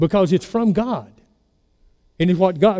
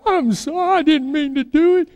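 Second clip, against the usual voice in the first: put-on much higher, childlike sorry voice; little brother apologizing; 10 semitones higher than usual. Little brother confessing to mother at table, childlike high apology. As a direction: squeak out a guilty kid's apology. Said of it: "And he would say".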